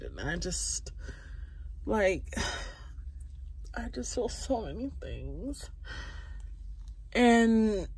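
near tears, breath catching with sighs; teary voice. Real, not acted. Telling viewers she feels ugly, close to crying. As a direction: hold back sobs as the words come out. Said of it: "I've been crying all day".

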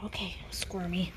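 soft whispered okay squirmy. Real, not acted. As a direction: breathe it gently to the wriggling pup. Holding wriggling puppy.

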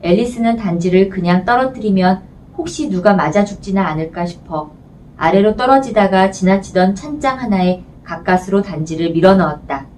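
usual speaking voice

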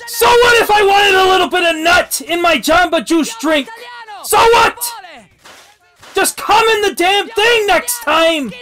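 high, loud outburst of outrage; ranting at full volume; furious shouting. Pretend. Father's outburst at the group.

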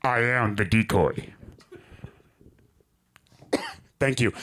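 low deadpan gruff voice; decoy voice. Acted. Comic drops low, playing the decoy in a sting. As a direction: drop the voice low and flat. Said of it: "my voice acting prowess".

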